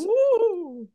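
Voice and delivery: high hooting ghost woo; spooky ghost woo. Pretend. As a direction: hoot it like a ghost. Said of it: "not like a spooky ghost".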